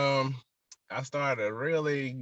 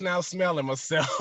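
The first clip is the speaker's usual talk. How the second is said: laughing mid-sentence at himself; self-mocking, higher-pitched and laughing; amused; 4 semitones higher, 5 dB louder than usual. Real, not acted. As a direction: break into a real chuckle as you admit it. Laughs at his younger self.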